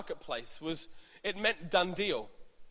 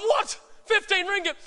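shouted, much higher disbelief; shocked; 13 semitones higher, 6 dB louder than usual. Retold or imitated. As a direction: yelp at the price in total shock. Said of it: "But, like".

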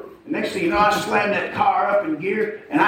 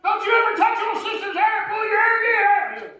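retold shout, much higher, at a misbehaving boy; angry dad yelling. Dad yelling at his son in the car, high angry shout. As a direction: bellow at the kid like a dad who's lost it. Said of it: "Screaming in the car".

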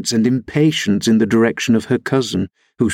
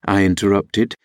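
usual speaking voice

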